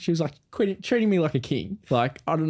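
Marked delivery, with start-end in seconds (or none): none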